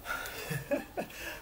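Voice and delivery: soft unvoiced chuckle; a quick airy laugh; breathy laugh. Real, not acted. Laughs at own joke.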